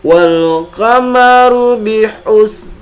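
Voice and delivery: slow, careful Quran recitation; over enunciating. Shown as a demonstration. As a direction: chant each syllable deliberately. Recites the verse.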